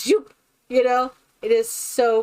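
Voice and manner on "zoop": high, sudden imitated whoosh; short upward sound effect; quick zip noise. Showing both cats' ears flicking, quick zip noise. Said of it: "their ear just goes".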